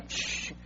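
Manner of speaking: sharp voiceless shush. Acted. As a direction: hush the complainer. Shushing a constant complainer. (chistido para callar)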